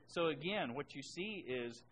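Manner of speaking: normal talking voice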